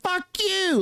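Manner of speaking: a cheery high "hey buddy" then the jab; lightly hammed-up politician sneering at a colleague; mock hallway insult. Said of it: "walk down the hallways and be like".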